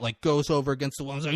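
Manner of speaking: usual speaking voice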